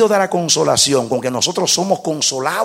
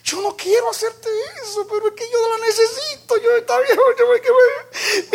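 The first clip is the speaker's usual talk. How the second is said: high, tearful, loud sobbing plea; crying plea; 4 dB louder than usual. Retold or imitated. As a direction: beg through tears, desperate. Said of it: "llorando me dijo".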